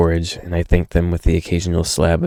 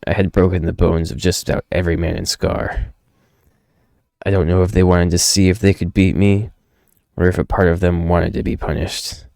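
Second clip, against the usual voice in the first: plain reading voice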